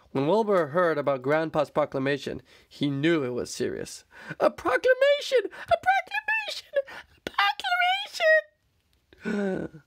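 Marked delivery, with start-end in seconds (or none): 4.4-9.8 very high squeaky shrieks; panicked squeal. Acted. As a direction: squeal it in alarm. Wilbur panicking.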